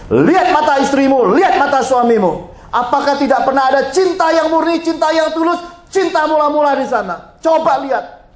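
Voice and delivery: high, loud impassioned appeal; passionate plea. Retold or imitated. Pastor pleading with the estranged couple to see their first love. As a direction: plead with them to look into each other's eyes.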